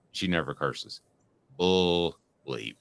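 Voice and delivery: normal talking voice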